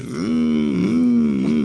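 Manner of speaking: high, drawn-out whining hum, the dog complaining; imitated dog whimper. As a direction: whine like a spoiled little dog. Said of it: "se queja de que no hay magdalenas". (gemido imitando al perro)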